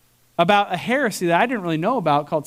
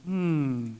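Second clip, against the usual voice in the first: low and much softer, musing; pondering gentleman; 6 semitones lower, 9 dB quieter than usual. Retold or imitated. Imitating the gentleman recalling his last sin, low musing. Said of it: "He's like".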